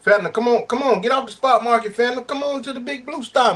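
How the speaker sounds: high, singsong dispatcher pitch; mock dispatcher call. Imitating a dispatcher calling a driver, high pitched pitch.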